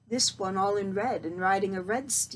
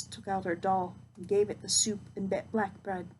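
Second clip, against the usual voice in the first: usual speaking voice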